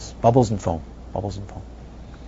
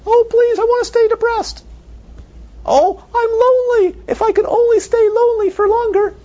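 high, loud hammed-up whine; screaming emotion; 5 dB louder than usual. Teacher mocks an emotion for students, high loud whine. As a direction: wail it like a melodramatic feeling. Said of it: "does an emotion scream like that".

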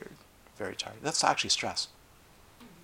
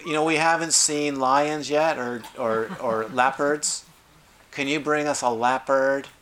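higher, louder, slow whiny demands; a spoiled tourist demanding his leopard; bored tourist pestering the safari guide, whiny and demanding; bored safari goer; 4 semitones higher, 5 dB louder than usual. Retold or imitated. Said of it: "Imagine a bored safari goer".

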